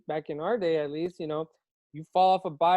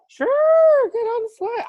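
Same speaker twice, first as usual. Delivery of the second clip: squeaky high voice, much louder; mock high-pitched chirp; 9 dB louder, slower than usual. Dad recalling his careless go-ahead, high and chirpy. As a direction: toss off a breezy yes in a high squeak. Dad's breezy reply retold.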